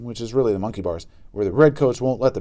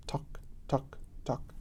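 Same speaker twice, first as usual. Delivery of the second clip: toe tapping; 3 semitones higher, 17 dB quieter, slower than usual. A narrator's reading. Toe tapping in tense silence.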